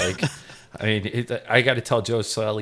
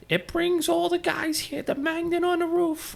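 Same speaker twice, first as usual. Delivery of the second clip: Joe Selly's high-pitched voice. Retold or imitated. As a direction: draw it out way up in a squeaky register. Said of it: "He had a high-pitched voice. He's like".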